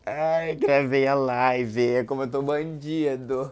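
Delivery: loud, slow, gleeful burst; excited relief. Real, not acted. After ending the fake live, loud and gleeful. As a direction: let the relief explode out.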